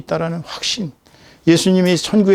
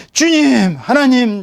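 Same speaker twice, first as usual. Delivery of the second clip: raised, high plea to the Lord; crying out to God; 6 semitones higher, 4 dB louder, much slower than usual. Acted. Sufferer with nowhere to go calls to the Lord, crying out. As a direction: call out high and loud in acted distress. Acted cry to God in distress.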